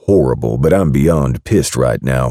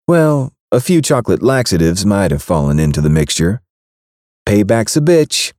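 higher, smug and pleased; gloating over the prank; smug woman's voice; 6 semitones higher than usual. Acted. Baker admitting the laxatives, smug voice. Kat confessing smugly.

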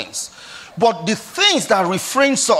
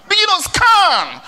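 high, slow, shouted command; preaching shout; 8 semitones higher, slower than usual. Acted. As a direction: call it out over the crowd. Preacher urges congregation to scan.

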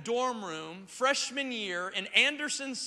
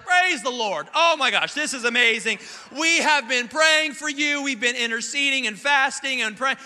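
louder, gushing praise from the parents; excited parents; 2 semitones higher, 7 dB louder than usual. Retold or imitated. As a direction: burst out thrilled like proud pastor parents. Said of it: "so they're like".

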